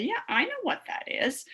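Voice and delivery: put-on high, eager kid voice; confident kid. Teacher voicing a ready student, high and eager. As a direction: sound sure like a keen student. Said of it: "you're gonna say".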